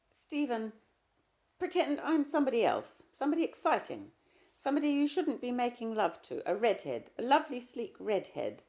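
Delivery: high, breaking plea; choking voice. A narrator's reading. Wife begging her husband in a choking voice. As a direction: push the words past a tight throat. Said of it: "in a choking voice".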